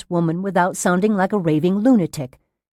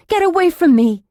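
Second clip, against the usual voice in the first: high, loud, frightened order; raised, desperate rebuff; panicked warning; 11 semitones higher, 6 dB louder than usual. A narrator's reading. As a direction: drive her away in alarm. Patient warding off the nurse.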